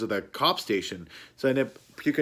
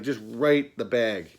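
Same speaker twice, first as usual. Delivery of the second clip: ordinary talk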